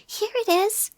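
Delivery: thrilled to find the present; excited cry. Acted. Christopher Robin finds the present, crying out excitedly. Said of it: "cried Christopher Robin excitedly".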